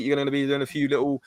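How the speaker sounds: ordinary delivery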